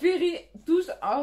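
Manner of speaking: loud, slow mock English accent; put-on English accent, showing how it's said; exaggerated English accent. Shown as a demonstration. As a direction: draw it out in a hammy English accent. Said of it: "faut prendre l'accent, euh, anglais".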